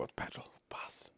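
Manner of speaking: near-whispered exasperated swear; muttered curse. Retold or imitated. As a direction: curse it under your breath. Discovers wrong fuel, cursing.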